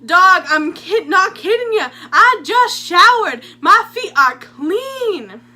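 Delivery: high, loud, slow protest; indignant Bob. Retold or imitated. Bob protests to the counselor, loud indignant voice. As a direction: insist loudly he showered. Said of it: "And he goes".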